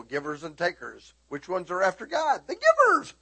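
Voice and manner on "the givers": high, loud triumphant cry; exultant shout. Acted. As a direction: shout the answer out. Shouted answer to congregation.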